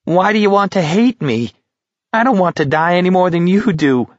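high, wheedling plea; whining. A narrator's reading. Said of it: "Markovich was whining".

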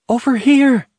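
high, loud call for help; screaming. A narrator's reading. Trapped mother calls to firemen from an upstairs window, screaming. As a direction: scream it out to be seen from far off. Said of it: "she began screaming".

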